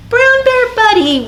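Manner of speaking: high loud welcome; excited greeting. Acted. Welcoming the puppet bear, high and excited. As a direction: greet him with a big burst.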